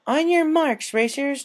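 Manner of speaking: high, loud starter's call; loud race call. Acted. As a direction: call the racers to the line. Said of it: "Twilight announced".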